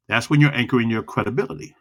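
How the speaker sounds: ordinary delivery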